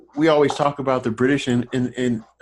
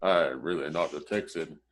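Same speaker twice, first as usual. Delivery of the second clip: confessing actor; 6 semitones lower, 4 dB quieter than usual. Retold or imitated. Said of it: "So I said".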